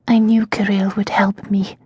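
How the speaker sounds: hushed, breathless whisper; barely voiced words of despair. Prisoner Tasia to her servant, whispered.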